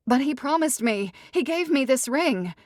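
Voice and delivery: high, loud pleading; cling to the promise; jilted bride insisting; wounded bride. Acted. Ruby protests.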